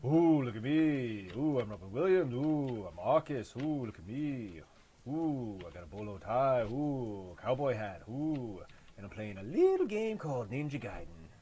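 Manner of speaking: overly animated comic impersonating someone laid-back; Robin Williams doing Arcus. Acted. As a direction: ham it up, whooping every phrase. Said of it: "Robin Williams doing an impression of Arcus".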